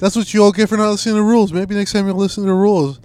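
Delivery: high, loud, fast mock of the boss; teacher mocks his boss, high, loud and fast. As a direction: rattle it off in a high mocking voice. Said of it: "she's like".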